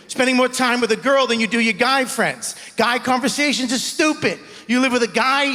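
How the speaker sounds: ordinary talk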